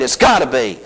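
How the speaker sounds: usual speaking voice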